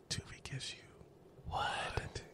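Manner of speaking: slow sultry whisper; sexy voice. Shown as a demonstration. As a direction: breathe it slowly like a seduction. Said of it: "we'll say it in the sexy voice".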